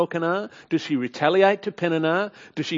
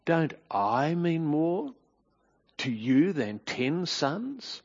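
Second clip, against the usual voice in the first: slow, softer, reproachful questioning; husband quietly reproaching his downhearted wife; tone of accusation; 6 dB quieter, much slower than usual. Shown as a demonstration. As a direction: press each question with quiet blame. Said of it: "Notice the tone of accusation".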